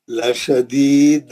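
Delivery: slow, drawn-out recitation with a bouncing qalqala at the end; tajweed recitation, strong qalqala on the final letter; Quran recitation. Shown as a demonstration. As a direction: recite it slowly and let the last letter echo. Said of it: "समझ गए ना आप लोग, कैसा पढ़ना है".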